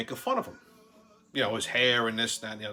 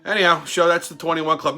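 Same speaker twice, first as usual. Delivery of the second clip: ordinary delivery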